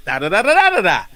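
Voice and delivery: lightly hammed-up [name] babble; [name] mumble imitation. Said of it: "He said".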